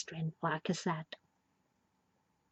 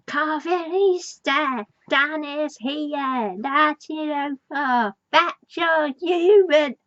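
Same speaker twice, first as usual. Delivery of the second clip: slow, higher, spelled-out reading; reading out the message; 5 semitones higher, slower than usual. Retold or imitated. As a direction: read the rock message word by word. Reading the painted rock message.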